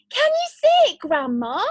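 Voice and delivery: very high, loud calling question; boy shouting up to grandma; excited shout. Acted. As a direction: call up to the roof. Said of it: "George shouted".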